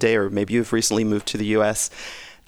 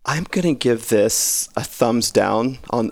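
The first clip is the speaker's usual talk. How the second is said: ordinary talk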